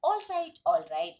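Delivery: acted grudging concession. Girl grudgingly corrects her exaggeration. (हार मानने का हल्का अभिनय)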